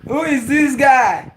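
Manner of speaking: high, slow, loud outcry; amused exclamation. Real, not acted. Streamer reacting to a viewer's joke, amused shout. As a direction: cry out, stretching it in amused disbelief.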